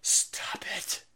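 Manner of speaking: hushed whisper. Real, not acted. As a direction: breathe it with no voice. Whispered aside to himself.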